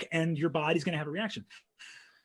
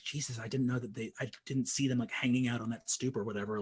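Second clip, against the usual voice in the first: softer, hurried huddled whisper-talk; softer, fast nervous aside; hushed huddle; 5 semitones lower, 9 dB quieter, faster than usual. Retold or imitated. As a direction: keep it low so they don't hear. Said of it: "we kind of huddle up and we're like".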